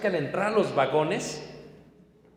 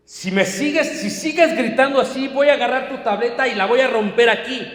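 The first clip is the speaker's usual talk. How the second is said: put-on higher, louder angry threat to a screaming child; imitating a yelling father; 5 semitones higher, 6 dB louder than usual. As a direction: bark the threat at the child on the plane. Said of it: "así gritando".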